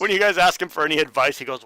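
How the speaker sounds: ordinary delivery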